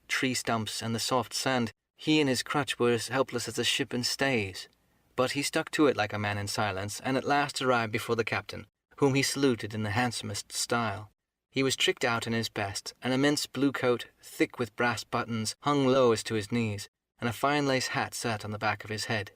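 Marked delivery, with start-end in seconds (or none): none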